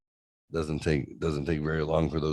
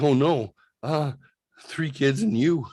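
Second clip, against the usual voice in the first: high, scolding dismay; mock dismay, pitched well up; retold exasperation; 8 semitones higher, 3 dB louder than usual. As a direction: imitate an overwhelmed companion scolding. Retold wife's exasperated reaction.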